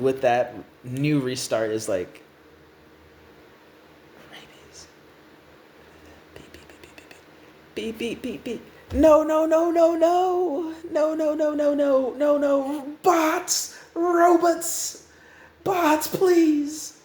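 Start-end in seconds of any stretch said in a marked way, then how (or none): 4.3-8.6 barely audible, whispered beeps; hushed. Acted. New Restart after a moment of silence, hushed beeping. Stunned character, then tiny beeping. 8.9-17.0 high, frantic cries; panicked. Acted. New Restart summons the robots, high and panicked.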